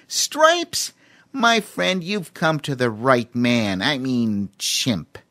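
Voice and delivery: high salesman chimp voice; boastful chimp. A narrator's reading. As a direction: pitch it like a showman chimp.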